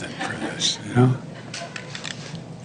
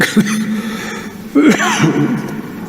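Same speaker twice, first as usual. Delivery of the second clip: coughing; 8 semitones higher than usual. Real, not acted. Speaker coughing mid-speech.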